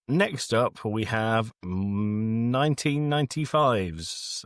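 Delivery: normal talking voice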